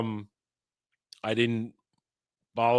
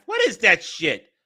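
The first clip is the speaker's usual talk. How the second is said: loud, raised-pitch dismissal; outraged; 6 semitones higher, 5 dB louder than usual. Real, not acted. Streamer cursing at a pasta video, outraged. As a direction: hurl it back at the screen. Outburst at sauce-pairing rule.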